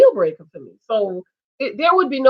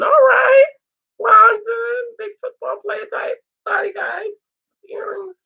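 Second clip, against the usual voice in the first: excited; 11 semitones higher than usual. Real, not acted. Excited about London's looks.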